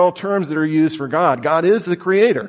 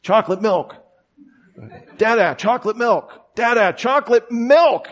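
mock toddler calling, building steadily higher and more insistent; a kid demanding chocolate milk, getting more impatient each time; retold toddler yelling; 8 semitones higher than usual. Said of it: "he was yelling to me".

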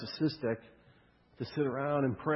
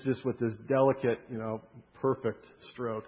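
ordinary delivery

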